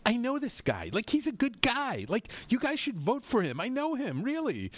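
high, eager voice of a fan vouching for a friend; gushing voter voice. Pretend. Host imagines telling listeners to vote, high gush. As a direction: pitch way up and gush about him. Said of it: "I can vote for somebody that I can say, like".

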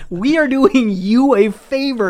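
put-on huffy outrage at the ungrateful kid; mock indignant drivers. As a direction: drivers scolding the kid who freaked out. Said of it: "they're just like".

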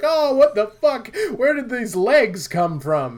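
high, loud startled outburst; baffled cry at sudden legs; shocked surprise. Retold or imitated. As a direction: yelp it in mock shock. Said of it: "and you're like".

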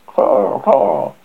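harsh croaking caw; raven croak. Acted.

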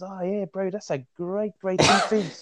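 high-pitched Island boy imitation, not truly whispered; mock sweet whisper. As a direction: sweet-talk an opponent in a put-on high voice. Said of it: "he just whispered in your ear".

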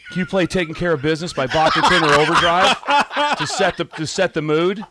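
loud, high rowdy request; rowdy caller voice. Retold or imitated. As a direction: yell the request with a laugh. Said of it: "you had the rowdy request".

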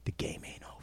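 urgent whispered challenge. Retold or imitated. Goading a famous player in a whisper. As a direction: lean in and whisper it hard. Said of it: "I started whispering".

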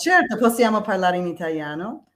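native Italian speech; speaking Italian. Shown as a demonstration. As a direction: answer naturally in Italian. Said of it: "can you say something in Italian".

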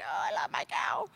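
put-on soft whispered little girl, low and breathless; whispered child's voice. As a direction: whisper it like a small child hugging her toy. Little girl quoted about cow.

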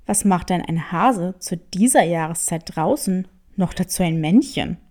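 low, sly tomcat questioning the hare; a low, sly cat sizing up a stranger. Acted. (tiefe, listige Katerstimme)

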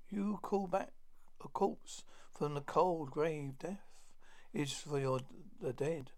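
ordinary delivery

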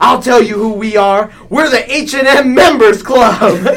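loud, high proclaiming voice; heroic announcement. Acted. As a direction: declare the team name proudly, laughing. Hero announces team name.

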